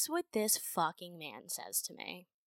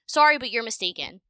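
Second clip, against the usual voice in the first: louder curt retort; 4 semitones higher, 9 dB louder than usual. Retold or imitated. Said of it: "That was my response to him".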